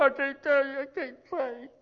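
high, wailing imitation of sobbed words; mock tearful. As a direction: blubber it out, hammed-up. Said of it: "just sitting there bawling".

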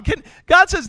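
ordinary talk, nothing marked

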